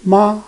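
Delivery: "Ma" high and level; first tone. Shown as a demonstration. Teacher reading the four tones, first "Ma" high and level. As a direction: hold "Ma" steady on a high note. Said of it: "read those four tones all together".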